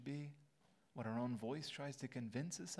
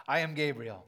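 booming, much louder angel proclamation; 13 dB louder than usual. Pretend. Preacher booming Gabriel's voice at the congregation. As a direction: announce yourself like a mighty angel. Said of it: "I don't know how to say that in an angel voice".

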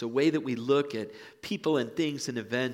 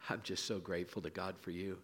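tender aside; 3 semitones lower, 7 dB quieter than usual. Pretend. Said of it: "your child next to you and say".

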